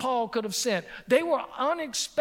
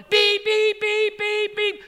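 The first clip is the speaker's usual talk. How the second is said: high, loud mock alarm beeps; squeaky hospital-bed alarm beeping; imitated alarm beep; 10 semitones higher, 6 dB louder, slower than usual.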